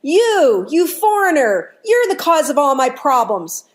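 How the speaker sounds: loud, high-pitched angry accusation; angry drunk. Acted. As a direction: point the finger and lash out as the drunk. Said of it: "he points at him real angry".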